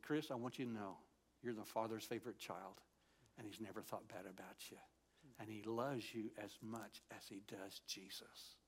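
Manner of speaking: hushed, low, tender reassurance; storge tone. Shown as a demonstration. Showing Chris how God spoke to him, tender storge tone. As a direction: speak softly as if to your own child, slowing at the end. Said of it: "a tone that women use when they speak to their children".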